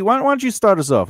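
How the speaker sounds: ordinary delivery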